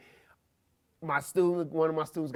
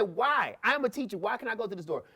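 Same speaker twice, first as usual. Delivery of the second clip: mildly indignant, higher-pitched challenge; difficult adult; 6 semitones higher than usual. Retold or imitated. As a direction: push back like a stubborn teacher. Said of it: "I'm gonna stop being difficult".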